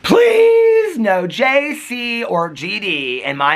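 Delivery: high, drawn-out mock plea; slow, sing-out motherly scolding, hammed up; imitated mother. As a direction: stretch PLEASE long and high, imitating mother. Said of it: "my mother used to say to me".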